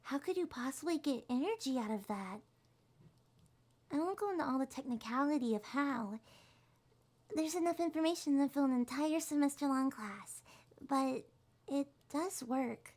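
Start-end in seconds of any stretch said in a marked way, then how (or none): none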